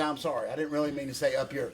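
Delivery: quiet, sheepish fan apologizing; sheepish apology. Retold or imitated. Fan apologizes at the gimmick table, quiet and sheepish. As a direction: mumble a sorry like an embarrassed fan. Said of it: "table and say".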